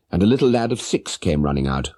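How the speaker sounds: normal talking voice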